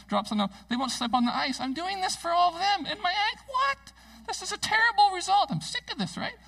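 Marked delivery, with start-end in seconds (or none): 1.6-6.3 much higher, climbing exasperated squeal; indignant rant. Retold or imitated. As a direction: pile on the outrage until it peaks at what. Retold driveway frustration.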